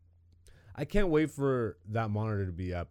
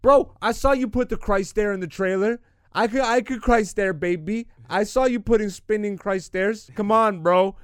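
loud, high, excited begging; excited pleading; 9 semitones higher, 12 dB louder than usual. Real, not acted. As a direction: beg for the game with full hype. Excited pleading about the game.

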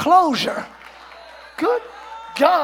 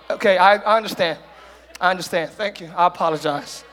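sincere apology; 8 semitones lower than usual. Shown as a demonstration. Preacher modeling a humble apology to an enemy, low and sincere. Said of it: "that takes a big person".

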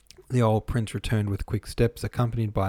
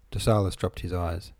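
ordinary delivery

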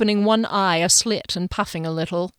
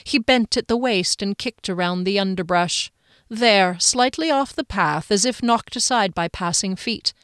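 plain reading voice

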